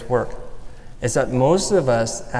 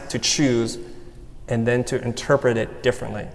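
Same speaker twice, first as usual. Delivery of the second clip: ordinary talk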